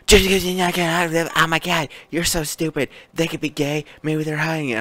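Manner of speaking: high-pitched, whiny caricature of an indignant fan; imitating an overwrought shipper arguing; mock outraged fan. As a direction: rush in high and loud, then stay high. Mocking an arguing fan's rant.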